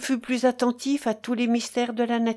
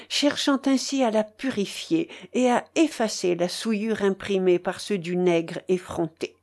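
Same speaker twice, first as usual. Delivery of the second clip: usual speaking voice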